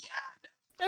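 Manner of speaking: high, quiet, tearful little yeah; teary mock yeah. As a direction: squeak it out through mock tears. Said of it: "fully teary-eyed ... is like".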